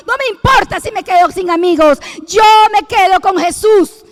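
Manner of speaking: preaching that grows to a loud cry; sermon climax rising from fervent to shouted; building preaching. Acted. Preaching climax, vow retold.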